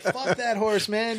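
laughing through a mock retort; half laughing, tossing it off. Retelling his reply to the Texas rep, laughing.

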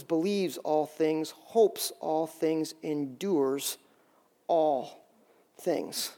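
slow, soft scripture reading, slowing to a near halt at the end. Acted. Pastor reading scripture to the church, slow and hushed. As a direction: read the love passage to the congregation, slowing and softening. Reads love passage aloud.

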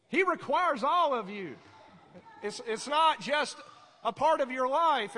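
preaching at full force; forceful preaching. Real, not acted. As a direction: press the point home hard.

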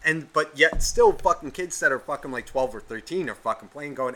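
ordinary talk, nothing marked

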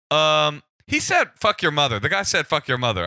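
high, loud disclaimer; flustered blurt. Retold or imitated. Interpreter to cop, disowning caller's insult, high flustered blurt. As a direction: blurt it out, breaking out of role.

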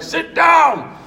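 high-pitched annoyed shout; imitated heckler's holler; audience shouting back. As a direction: yell it across a theater. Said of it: "They were like".

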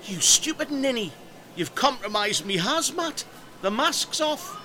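high, loud indignant scolding; outraged. Acted. Outraged at mask being pulled off.

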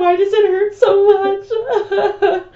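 high, loud, whiny sob, hammed up; mock crying. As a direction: wail it like a tearful teen. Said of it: "Because I was just like".

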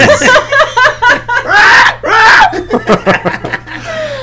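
high, shrieking comic pig squeal. Pretend. Host adding animal noises to the ad, squealing pig impression. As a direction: squeal like a pig, badly enough to pass for a bird. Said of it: "that was supposed to be a pig".

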